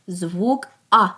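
ordinary talk, nothing marked

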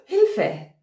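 high, loud cry for help; frightened cry. A narrator's reading. Girl facing the wolf cries for help, high and scared. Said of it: "Rotkäppchen erschrak".

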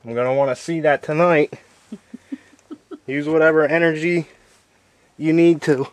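ordinary talk, nothing marked

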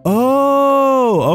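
long, high-pitched "oh" of discovery; surprised and enlightened; sudden realization. Real, not acted. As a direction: draw it out high as it dawns. Realizes how to go back.

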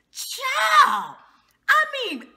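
high, loud exclaim of shock; disbelieving shout. Real, not acted. Stunned by Tiffany, high loud shout.

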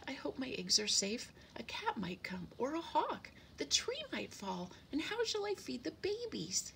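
high, fretful little bird voice; anxious chirpy worrier; worried sparrow. A narrator's reading. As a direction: fret through each fear, pitch jumping up. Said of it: "he was looking very, very worried".

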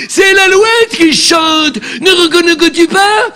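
drawn-out pleading Juliette calls to Roméo; melodramatic Juliette. Acted. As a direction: overplay Juliette's balcony lament. Comedian declaims Juliette's lines.

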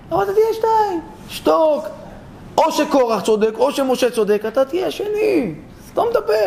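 high-pitched acted nagging wife; exasperated scolding. Wife telling her husband to keep out of the dispute, exasperated.

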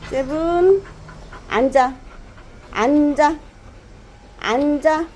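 talking to a dog. Real, not acted. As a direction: drill the dog firmly on sit. Repeating sit command to dog.

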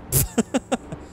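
short breathy chuckle; real mischievous chuckle; cheeky laugh. Real, not acted. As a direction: laugh while cutting someone off. Cutting in front of another driver.

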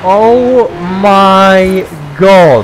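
real amazement; awed oh my god. Real, not acted. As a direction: stretch it out in awe. Driving the supercar.

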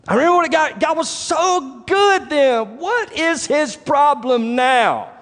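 high, drawn-out mock exasperation; exasperated complaint. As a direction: stretch it out like a baffled, fed-up believer. Acts out the psalmist's baffled complaint.

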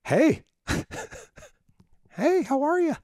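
high bright mimicked phone hello; mock Kathy greeting. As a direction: chirp it way up like the sister answering.

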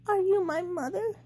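put-on high, small baby bird asking; a lost little chick asking the hen. A narrator's reading. Little bird asks the hen, high and small. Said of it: "he said to the dog".

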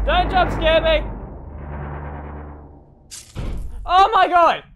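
panicked loud pleading; scared pleading yell. Real, not acted. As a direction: beg in real fright, then yell. Begging not to be jumpscared.

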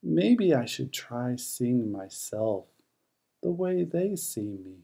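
plain reading voice

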